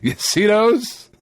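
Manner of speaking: imitating a doctor let down by the patient; matter-of-fact doctor. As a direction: point it out like anyone could see it. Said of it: "he was just so matter-of-factly".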